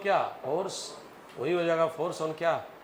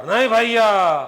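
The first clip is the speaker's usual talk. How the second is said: higher, loud tearful cry of denial; loud protest; 5 semitones higher, 12 dB louder, slower than usual. Retold or imitated. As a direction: cry out like the upset mother. Crying mother denies loudly.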